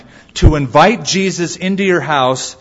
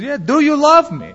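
much higher, pressing question; insistent husband; 12 semitones higher than usual. Retold or imitated. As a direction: press her for an answer, voice raised. Husband repeats his question.

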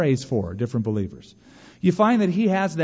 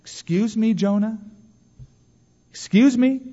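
high-pitched, incredulous challenge with questioning pauses; mock disbelief; 8 semitones higher than usual. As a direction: call him out as if you can't believe it. Preacher challenging Jonah in mock disbelief.

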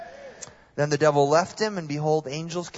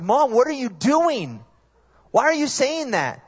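freaking out; 9 semitones higher, 5 dB louder than usual. Retold or imitated. Son asking mom why she is chanting, high and panicked. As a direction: plead with mom in mock panic. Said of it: "I start freaking out".

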